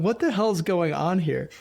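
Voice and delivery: baffled disbelief. Retold or imitated. Interviewer recalling finding another man on the test, baffled disbelief. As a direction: retell your stunned reaction, stretching the question. Retold shock at wrong man.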